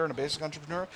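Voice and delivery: ordinary talk, nothing marked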